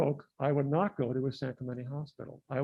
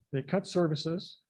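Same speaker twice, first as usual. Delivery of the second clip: normal talking voice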